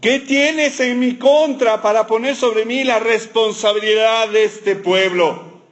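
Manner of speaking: lightly mock-whining Moses; whiny Moses. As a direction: drag it out like a martyr. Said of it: "Era un poco quejoso".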